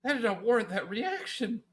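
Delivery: high-pitched mock exasperation; incredulous reaction. Retelling own reaction to the screamer, high incredulous complaint. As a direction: protest in baffled disbelief. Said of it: "I'm just like".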